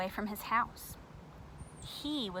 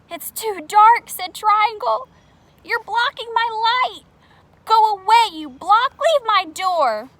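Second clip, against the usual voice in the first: high, loud panicked pleading; frightened shriek; 12 semitones higher, 17 dB louder than usual. Acted. Scared Triangle in the dark yelling at Square, high panic. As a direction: shoo the block away in a panic.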